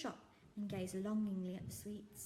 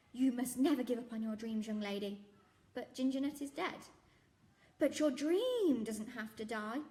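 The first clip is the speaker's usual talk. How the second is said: encouraging shopkeeper; 3 semitones higher, 7 dB louder than usual. Acted. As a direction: urge her not to give up. Raj encouraging.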